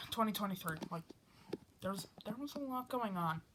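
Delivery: ordinary talk, nothing marked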